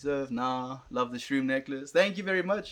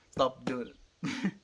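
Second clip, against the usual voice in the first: slow mock scolding; much slower than usual. Real, not acted. Scolding viewers about bad content.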